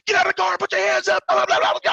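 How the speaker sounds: high, rapid officer's yell; shouted police orders. Retold or imitated. Officer ordering an armed man out of the car, shouting. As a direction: bellow orders as the alarmed officer, hammed up.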